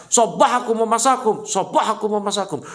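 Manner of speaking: high, forceful battle warning to the troops; war commander. Acted. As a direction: raise the alarm like a commander rousing soldiers. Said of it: "panglima perang yang mengatakan kepada pasukan".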